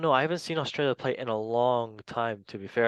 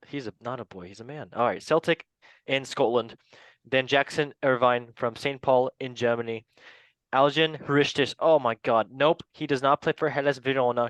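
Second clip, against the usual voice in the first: ordinary delivery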